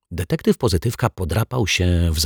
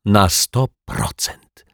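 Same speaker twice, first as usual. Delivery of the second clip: slow, deliberate assurance; firm certainty; 3 semitones higher, slower than usual. A narrator's reading. Detective staring Martwiak down, slow and firm. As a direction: lay down each word calmly.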